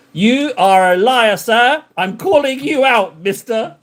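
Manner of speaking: high, drawn-out mock indignation; mock accusation. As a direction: playfully call them out, pitched high and slow.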